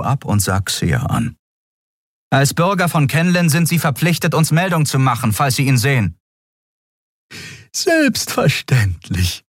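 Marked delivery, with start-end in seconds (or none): 2.3-6.2 higher, toneless official statement; toneless guard. A narrator's reading. The guard explains the reward toneless, higher. 7.7-9.4 higher, slow cheerful of course; friendly assurance. A narrator's reading. Xia reassures the guard friendly, higher and slow. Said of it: "versicherte Xia freundlich".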